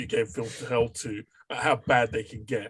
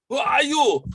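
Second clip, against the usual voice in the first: high, loud action-hero challenge; shouted demand; 13 semitones higher, 6 dB louder than usual. Retold or imitated. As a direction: shout it at the Nazis. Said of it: "that's the Wonder Woman for you".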